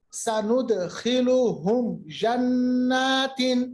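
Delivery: سَنُدْخِلُهُمْ جَنَّاتٍ slowly recited, mim straight into jim; tajweed demonstration. Shown as a demonstration. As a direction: recite جَنَّاتٍ with drawn-out nasal nun after هُمْ. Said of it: "اور فوراً ہم نے پڑھا جنات". (تجوید کے ساتھ ٹھہر ٹھہر کر تلاوت)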